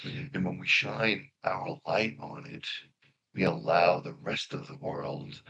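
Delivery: soft, unhurried meditation guide voice; guided meditation. Acted. Guiding the congregation into meditation, soft and calm. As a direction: ease them into stillness, softly.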